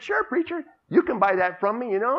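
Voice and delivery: put-on high, eager young boy voice. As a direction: pitch up as a cheerful kid.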